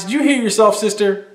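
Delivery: high-pitched disbelieving rebuke; as if she can't believe her ears; incredulous scolding. Real, not acted. Calling out the sister in the news clip, high incredulous rebuke.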